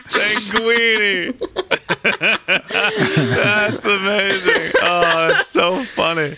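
slow, high, through laughter; can barely talk for laughing; laughing hard. Real, not acted. Cracking up at the pun.

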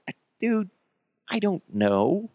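ordinary delivery

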